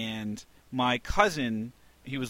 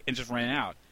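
ordinary talk, nothing marked

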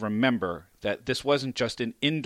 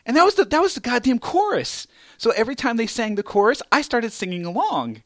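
excited; 11 semitones higher, 6 dB louder than usual. Real, not acted. Excited story climax.

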